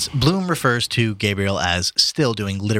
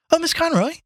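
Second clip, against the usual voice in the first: high-pitched greeting as a maid; maid Lily's voice. Acted. Maid to arriving guest at the door, high girlish greeting. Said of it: "said Lily to Gabriel".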